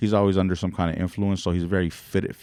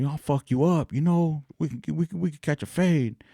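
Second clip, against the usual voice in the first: mock threatening host; 9 semitones higher than usual. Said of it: "the host is still like".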